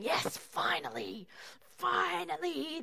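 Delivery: slow gloating, savouring each finally; smug, drawn-out relish. Retold or imitated. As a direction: rub your hands and drawl it out. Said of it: "rubbing their hands together".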